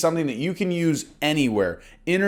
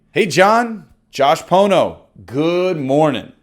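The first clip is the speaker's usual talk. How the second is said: warm confident greeting; 6 dB louder, slower than usual. Shown as a demonstration. Agent pretending to call John, warm and confident. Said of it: "the script gets delivered like this".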